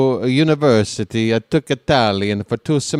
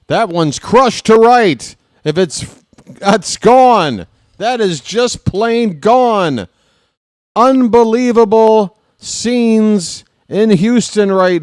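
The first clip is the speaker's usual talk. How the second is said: higher and louder, yelling with joy; home run call; 7 semitones higher, 6 dB louder than usual. Real, not acted. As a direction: lose it over a massive homer.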